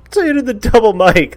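mimicked quote. Imitating McGregor grabbing the mic.

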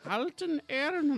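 put-on high-pitched German-accented name reading; mock German accent. Podcaster shows how the German wife would say it, mock German accent. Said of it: "if you pronounce it like my wife".

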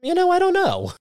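sudden high-pitched retold backtrack; snapping back to reality. Scientist answering after her rant, imitated high-pitched snap back to reality. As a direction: jump way up in pitch, imitating someone coming out of a rant. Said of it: "kinda snaps back to reality".

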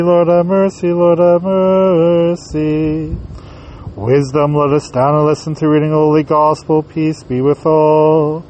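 ordinary talk, nothing marked

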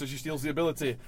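ordinary delivery

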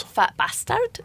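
high, quick Scottish Shrek line; playful Scottish accent. Pretend. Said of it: "Just, just do a Shrek accent".

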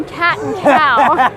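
usual speaking voice

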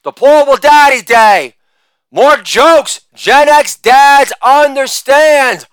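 ordinary talk

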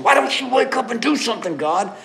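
high, loud, exasperated plea; impatient cry to God. Pretend. Believer complaining to a seemingly calm God, exasperated high loud plea. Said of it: "that's why we're saying".

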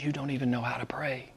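hushed whisper; whispered quote. Acted. Preacher quoting Paul to the congregation, whispered. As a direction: breathe it as a secret.

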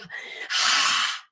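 long open exhale through the mouth; slow mouth exhale. Shown as a demonstration. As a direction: breathe out slowly through the mouth. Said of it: "breathing out through the mouth".

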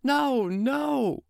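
very high wife's voice, pleading; the wife begging him not to waste the wish; high pleading cry; wailing wife. Acted. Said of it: "His wife began to cry and bawl".

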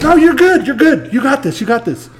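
mock carefree brother. Brother waving him off while dancing, hammed-up high breezy voice. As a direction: ham up the brother who won't help. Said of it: "And he goes".

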